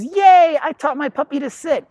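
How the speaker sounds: high, loud, gushing cheer; hammed-up owner thrilled with themselves; squealed yay, then proud; mock proud owner. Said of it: "The human goes".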